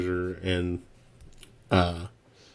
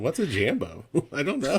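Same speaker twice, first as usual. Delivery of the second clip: playing along innocently; 6 semitones higher than usual. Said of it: "[name] was playing right along".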